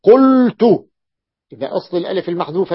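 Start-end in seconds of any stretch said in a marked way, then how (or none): 0.0-0.6 قلت said high and loud as the passive form qultu with u; the passive قلت, pitched up and loud; قلت with a rounded u, high and loud; passive vowel demo. Shown as a demonstration. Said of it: "بصير مبني للمجهول".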